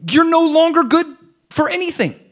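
high, loud voice of Jesus rebuking. Retold or imitated. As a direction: raise the voice as Jesus scolding. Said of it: "he's like".

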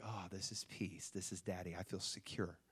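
soft, low sigh of contentment; quiet, secure calm of a comforted newborn; soothed baby. Retold or imitated. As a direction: settle into hushed, peaceful relief. Imagined calmed baby, retold.

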